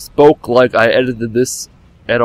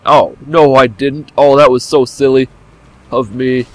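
usual speaking voice

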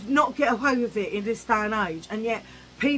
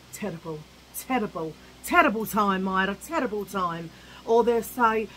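ordinary delivery

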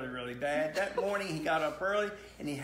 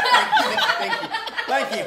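much higher and louder, squealing with laughter; laughing; 12 dB louder, faster than usual. Real, not acted. Dad reading to the kids, squealing with laughter.

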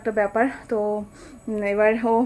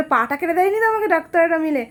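retold worried question, pitched up; tense; 6 semitones higher, 4 dB louder than usual. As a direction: ask it in mock alarm. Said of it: "আমি আরও tense হয়েছি".

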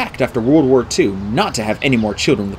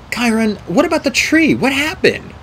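put-on high, urgent girl's voice; anxious girl; 11 semitones higher than usual. A narrator's reading. Girl asks about the poisoned tree, anxious. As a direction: press anxiously, pitched well up.

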